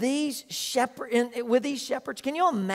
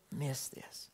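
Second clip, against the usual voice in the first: a softer, unvoiced hush; whisper; 10 semitones lower, 17 dB quieter than usual. Real, not acted. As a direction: lean in and breathe it as a secret. Hushed warning in sermon.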